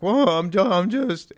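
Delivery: high, loud, stammering panic; mock freaking out. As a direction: imitate someone flustered and caught off guard. Voices Jordan freaking out at cop.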